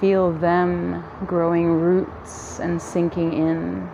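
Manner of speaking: ordinary talk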